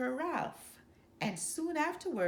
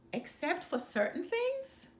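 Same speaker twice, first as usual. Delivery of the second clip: musing; 9 semitones higher than usual. A narrator's reading. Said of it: "mused their father".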